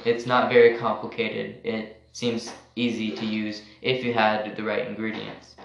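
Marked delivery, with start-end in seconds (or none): none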